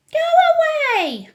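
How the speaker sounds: very high, loud indignation; shrieky, affronted wife; outraged mother. A narrator's reading. Mrs. Jenkins complains of the grandmother, high and outraged.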